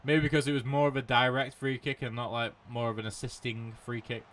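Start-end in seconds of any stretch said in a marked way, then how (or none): none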